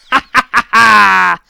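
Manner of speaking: loud goblin belly laugh. Acted. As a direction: roar with laughter. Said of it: "laughed the Goblin King".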